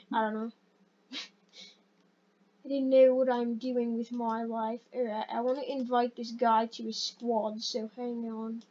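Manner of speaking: put-on half-hearted British accent, a little subdued. Gamer inviting a player to squad in a put-on British accent. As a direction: slip into an amateur British accent. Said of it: "I need to stop talking with a British accent".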